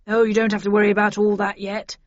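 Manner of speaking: a hint of a jovial chief inspector; jovial police boss. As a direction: brush it off like a genial boss.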